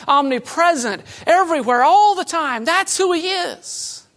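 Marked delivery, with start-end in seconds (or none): 2.6-4.1 slow emphatic. Acted. Sermon climax, slowed emphatic line.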